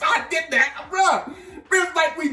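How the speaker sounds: loud, high laughing exclamation; incredulous laughing shout; laughing outburst. Real, not acted. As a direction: crack up while owning up to it. Cracks up at his own repeat.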